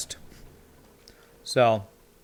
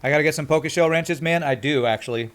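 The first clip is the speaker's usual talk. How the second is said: chewing while talking. Real, not acted. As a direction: mouth full. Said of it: "sorry I'm talking while I'm eating".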